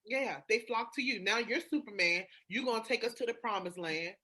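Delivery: ordinary talk, nothing marked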